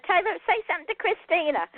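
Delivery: playful call across the room; half-hearted teasing holler; mock holler. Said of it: "I was gonna holler".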